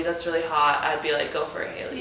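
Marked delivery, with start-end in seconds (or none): 1.3-2.0 a hushed whisper of encouragement; whispering go for it to a friend. Pretend. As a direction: breathe it like a secret cheer. Friend imagined cheering her on.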